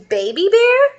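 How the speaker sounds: high, loud startled question; surprised. Acted. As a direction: mum recognising an old friend, amazed. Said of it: "said the mommy person".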